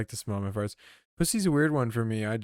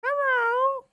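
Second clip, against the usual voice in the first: very high falsetto squeak of a little puppet; Elmo-like puppet voice. Pretend. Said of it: "He doesn't talk like Elmo".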